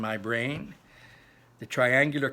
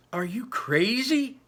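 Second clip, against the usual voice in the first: demanding; 11 semitones higher, 6 dB louder, slower than usual. A narrator's reading. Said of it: "I demanded".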